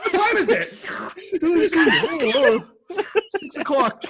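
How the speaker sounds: high, laughing bit; laughing mock question. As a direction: ask the time while cracking up. Acting out the watch gag.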